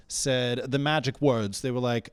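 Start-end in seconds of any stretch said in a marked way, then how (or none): none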